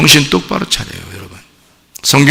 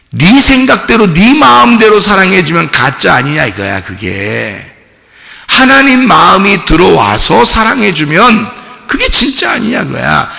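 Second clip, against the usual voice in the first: fiery preaching; 5 semitones higher than usual. Acted. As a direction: preach it out with force. Preaching at full force.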